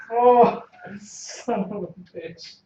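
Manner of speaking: loud, exasperated outburst at bad luck; frustrated curse. Real, not acted. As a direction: groan it out, annoyed. Failed roll reaction.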